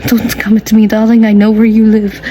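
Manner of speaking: loud sassy threat; loud mock threat. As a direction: belt out a diva warning.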